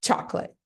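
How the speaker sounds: Chocolate as a short CHAWK-luht; English model for imitation. Shown as a demonstration. Teacher asks students to imitate, brief two-syllable English Chocolate. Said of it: "reproduzir o mesmíssimo som que eu tô fazendo".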